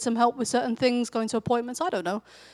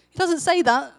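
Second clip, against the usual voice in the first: normal talking voice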